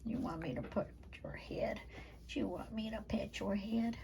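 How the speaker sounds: soft unvoiced whisper to the pup; whispering to a sleepy puppy. Real, not acted. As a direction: barely breathe the question.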